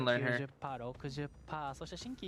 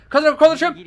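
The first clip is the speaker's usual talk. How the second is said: high loud urgent shout; urgent call; 12 semitones higher, 12 dB louder, much faster than usual. Real, not acted. Streamer urging a teammate to crawl to safety, urgent shout. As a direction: shout the instruction at him.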